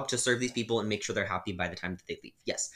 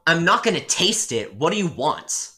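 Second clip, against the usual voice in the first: loud, higher, indignant retort; firm refusal; 5 semitones higher, 9 dB louder than usual. Retold or imitated. Barista refusing the rude customer, loud and indignant. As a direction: snap back and refuse outright.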